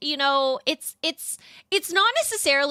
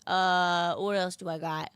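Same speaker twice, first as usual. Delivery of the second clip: usual speaking voice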